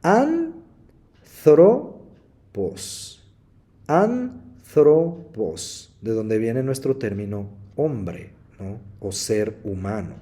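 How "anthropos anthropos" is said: Anthropos very slow, first syllable AN stressed. Shown as a demonstration. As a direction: drag out Anthropos, leaning on AN. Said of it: "se llama proparoxítonas".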